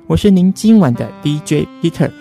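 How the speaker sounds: ordinary delivery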